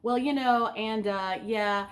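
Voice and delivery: halting, drawn-out ums and uhs; filler-laden hesitation. Shown as a demonstration. Speaker demonstrating empty words to avoid, hesitant and stalling. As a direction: act out groping for the next thought. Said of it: "be aware of empty words".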